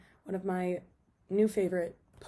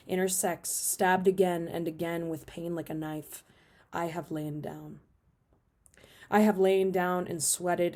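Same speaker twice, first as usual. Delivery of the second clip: measured, emphatic poetry recital; poem reading; 6 dB louder than usual. Acted. Reading a favorite poet's work to video viewers, emphatic poem reading. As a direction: read the poem out with punched line openings.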